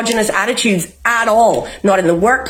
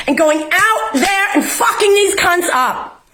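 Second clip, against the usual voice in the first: fired-up shout; 6 semitones higher than usual. Real, not acted. Fired-up rant about going out.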